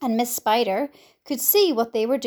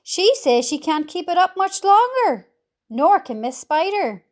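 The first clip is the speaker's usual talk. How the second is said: high, urgent raised voice; yelling; 6 semitones higher, 4 dB louder than usual. A narrator's reading. Centipede reporting that the spinners are tiring, yelling. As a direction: call the bad news upward. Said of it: "yelled the centipede from below".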